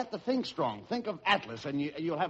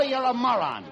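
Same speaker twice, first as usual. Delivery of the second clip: normal talking voice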